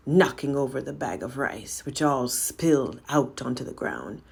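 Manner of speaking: plain reading voice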